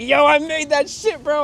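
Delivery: high, loud, drawn-out boast; excited exclamation. Retold or imitated. Claiming his own beat at a function, high excited yell. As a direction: blurt it out proudly, high and loud.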